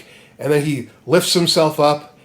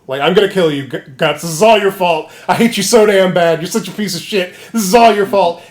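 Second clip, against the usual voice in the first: raised, higher-pitched angry blaming; mock furious rant; 4 semitones higher, 4 dB louder than usual. Retelling Griffith's threats to Guts, in a mock furious rant. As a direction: rant accusingly, voice pushed up and loud.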